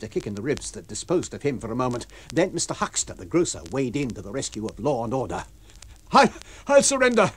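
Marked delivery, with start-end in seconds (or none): 6.1-7.3 loud, high cry of giving up; desperate cry. Acted. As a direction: cry out that he surrenders. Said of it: "cried the stranger".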